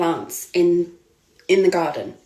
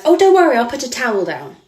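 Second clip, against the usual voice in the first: higher, louder breezy mock voice; mocking the photographer; 4 semitones higher, 3 dB louder, faster than usual. Photographer dismissing her fear of spiders, high mocking voice. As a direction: brush it off like he did. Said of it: "And he went".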